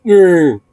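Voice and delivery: high, loud playful growl; imitated dog growl. Retelling how his dog grumbled, a high loud mock growl. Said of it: "He just went like".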